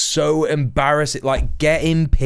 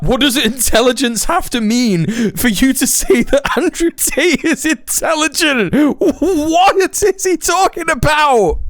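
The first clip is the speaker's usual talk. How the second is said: very high squeal of disbelief; incredulous outburst; 11 semitones higher than usual. Real, not acted. Streamer can't believe Tate is called intelligent, squealing exasperation. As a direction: let disbelief climb into a squeaky wail.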